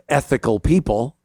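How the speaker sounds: ordinary talk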